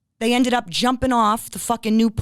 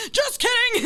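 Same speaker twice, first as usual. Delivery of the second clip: very high, comic squeal of words; mock prankster; 12 semitones higher than usual. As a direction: tease it in a silly high voice. Mocking the jumper's imagined prank.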